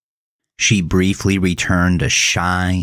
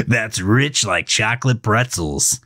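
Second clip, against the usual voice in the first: mocking; 6 semitones higher, slower than usual. A narrator's reading. Melvin mocks Gavin.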